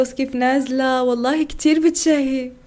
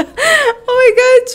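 drawn-out high Oh my God through laughter; very slow, high-pitched and loud; excited gasp; 11 semitones higher, 6 dB louder, much slower than usual. Real, not acted. A fasting vlogger reacts to the food video with a laughing, drawn-out cry.

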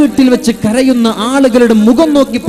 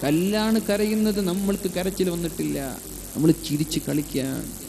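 much softer, lower, muttering it half to himself; hushed aside; 6 semitones lower, 17 dB quieter than usual. Real, not acted. Preacher to congregation, a soft rueful aside. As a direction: drop the voice to a rueful murmur.

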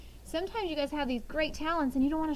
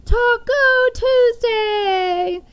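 much higher, louder, very slow delighted cry; excited shout; 10 semitones higher, 13 dB louder, much slower than usual. Retold or imitated. Four-year-old answering the doctor, shouting with joy. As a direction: blurt it out with pure kid joy. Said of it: "he goes".

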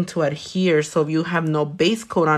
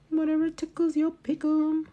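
high teasing quip; playful diva sass; sassy high voice; 11 semitones higher, 5 dB quieter than usual. Pretend.

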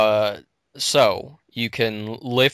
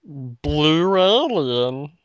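alien voice; 4 semitones higher, much slower than usual. Pretend. Mock alien voice.

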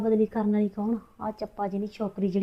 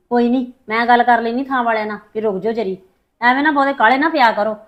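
loud heated arguing; 2 semitones higher, 10 dB louder than usual. Acted. Wife argues loudly with husband.

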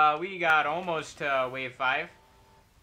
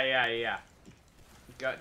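ordinary delivery

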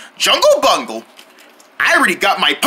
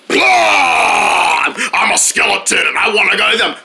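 spooky skeleton. Acted. Skeleton jump scare.

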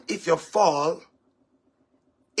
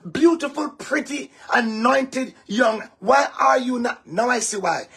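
usual speaking voice

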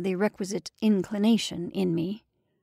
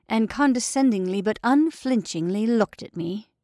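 plain reading voice

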